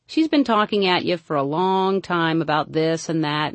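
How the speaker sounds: ordinary talk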